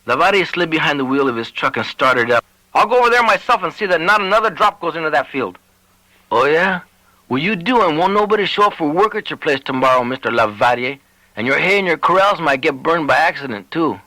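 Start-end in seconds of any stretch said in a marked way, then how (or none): 2.7-5.6 higher, huffy rancher threat; blustering Lavadier. Acted. Lavadier voiced, angry threat. 6.3-14.0 put-on higher, cocky young man threatening back; defiant Joe. Joe voiced, defiant counter-threat.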